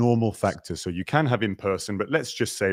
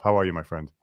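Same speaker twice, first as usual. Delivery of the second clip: usual speaking voice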